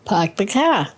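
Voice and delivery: classic Maine accent, r dropped in "Park" and "car". Shown as a demonstration. Grandma shows her granddaughter how she says it, Maine accent. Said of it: "Tell us how you would say".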